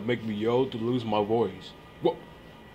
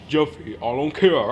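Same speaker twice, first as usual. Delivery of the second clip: normal talking voice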